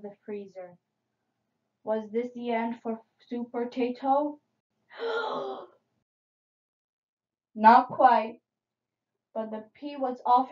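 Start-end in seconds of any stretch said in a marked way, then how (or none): 5.0-5.6 high, soft dramatic gasp; suspense gasp. A narrator's reading. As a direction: gasp for the imperilled hero. Suspense over hero's fate.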